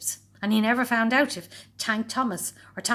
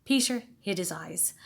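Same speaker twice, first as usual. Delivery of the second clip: plain reading voice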